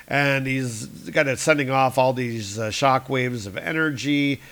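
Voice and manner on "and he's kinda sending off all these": ordinary talk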